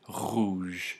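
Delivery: rouge with a slow voiced throaty French R. Shown as a demonstration. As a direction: say rouge with the R buzzing at the uvula. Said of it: "rouge is voiced".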